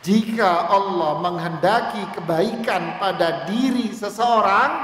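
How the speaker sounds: loud, rising sermon delivery; preaching. Real, not acted. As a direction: proclaim the promise with fervour. Preaching again, louder.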